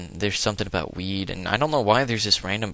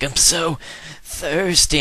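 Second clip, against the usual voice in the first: put-on high, loud whine of a parched kid; mock whiny NPC; 8 semitones higher, 4 dB louder, slower than usual. Gamer voices a starving trainer, hammed-up whine. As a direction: wail it like a hammed-up desperate kid.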